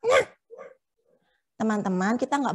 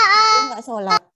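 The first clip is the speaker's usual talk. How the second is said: mock shock; 10 semitones higher, 8 dB louder than usual. Teacher shocked puppet skips prayer.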